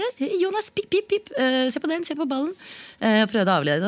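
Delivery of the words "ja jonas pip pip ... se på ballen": very high sing-song dog talk; high cooing voice to distract a barking dog; baby talk to the dog. Retold or imitated. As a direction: coax the dog in a squeaky voice. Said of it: "så jeg sendte".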